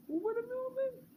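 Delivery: high-pitched squeal of laughter through the words. Real, not acted. Laughing at the memory of her brother's stunt, squealing.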